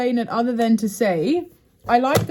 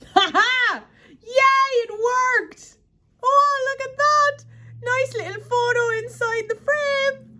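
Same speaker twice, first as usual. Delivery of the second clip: thrilled, much higher shrieking; delighted squeal; 13 semitones higher, slower than usual. Real, not acted. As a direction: squeal with joy when it works. Photo fits in the frame.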